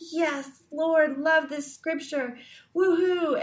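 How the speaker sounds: high, bubbly mock cheer building to a whoop; mock enthusiasm. Believer gushing over scripture in a hammed-up mock cheer. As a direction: ham up a gushing devotional moment.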